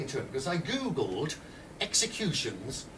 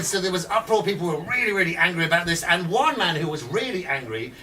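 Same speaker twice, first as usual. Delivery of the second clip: ordinary delivery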